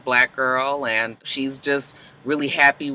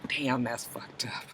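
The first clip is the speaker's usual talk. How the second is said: whispered aside, slowing down; very soft, whispered reaction; hushed dismay; 3 semitones lower, 16 dB quieter, slower than usual. Retold or imitated. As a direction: drop to a whisper in disbelief.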